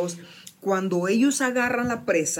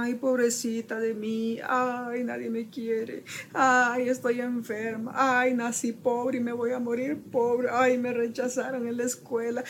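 a whiny voice imitating someone sorry for herself; self-pitying whine; 4 semitones higher than usual. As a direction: sigh each ay like a sulky martyr. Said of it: "por la lástima propia".

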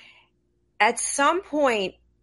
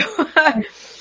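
tearful laugh; 7 semitones higher, 6 dB louder than usual. Real, not acted. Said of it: "I'm crying now too".

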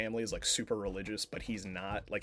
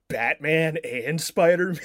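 loud, higher, drawn-out disbelief; incredulous reaction; 5 semitones higher, 9 dB louder than usual. Retold or imitated. Retelling their reaction to the casting, incredulous. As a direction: act out your own astonished reaction, slowly.

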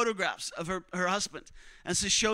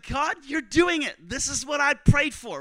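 loud, high burst of joy to God; overjoyed; 7 semitones higher, 7 dB louder than usual. Retold or imitated. Preacher retelling his thanks to God, overjoyed. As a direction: cry it out, high and elated. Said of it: "I felt so happy".

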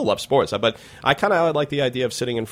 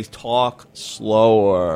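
slow caller demand; 3 semitones lower, much slower than usual. Retold or imitated. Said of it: "People would call in and say".

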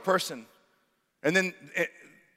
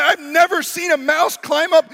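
higher, louder, incredulous; skeptical retort; 10 semitones higher, 8 dB louder than usual. Retold or imitated. Pastor doubting the salesman's story, incredulous. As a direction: push back with disbelief. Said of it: "I'm like".

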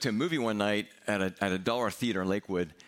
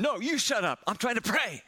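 yelling back; 12 semitones higher than usual. Retold or imitated. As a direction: fling it back at the neighbor at full voice.

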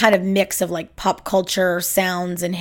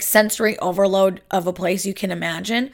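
usual speaking voice

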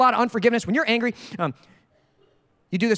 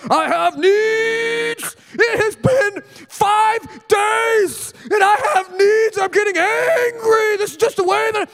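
high, slow, whiny mock shout; demanding husband; 12 semitones higher, 5 dB louder, much slower than usual. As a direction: wail like a husband with demands, pitched way up. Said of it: "or do you demand it?".

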